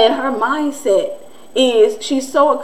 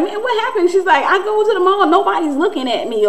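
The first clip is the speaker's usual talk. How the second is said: higher-pitched mock complaint; quoted griping in a raised pitch; exasperated complaint; 7 semitones higher than usual. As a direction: imitate a put-out sister, voice jumping up high. Retells sister's exasperated complaint.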